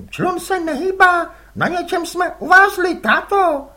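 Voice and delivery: put-on a boy asking innocently; curious boy. Les asks his father whose squid they are, boyish.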